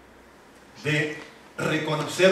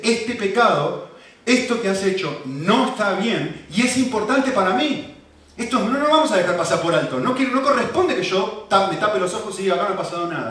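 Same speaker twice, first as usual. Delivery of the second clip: loud, high, emphatic righteous anger; raised voice laying down the law; indignant God; 5 semitones higher, 6 dB louder than usual. Acted. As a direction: voice God's firm displeasure, pressing each point. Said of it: "la persona que más se enoja".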